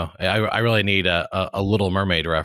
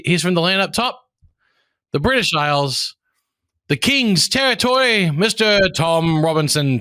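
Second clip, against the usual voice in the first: much higher, louder, slowing into the name; grand announcer intro; 10 semitones higher, 5 dB louder than usual. Pretend. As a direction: announce the guest like royalty arriving.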